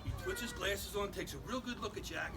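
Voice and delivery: usual speaking voice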